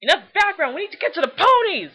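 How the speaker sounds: loud, high, eager call; excited shout. Real, not acted. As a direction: burst out, can't wait any longer. Excitedly moving on to ponies.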